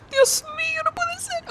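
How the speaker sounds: high, teary exclamation; crying out. Real, not acted. Reader wailing in Spanish over the book's ending. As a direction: cry out in disbelief, near tears.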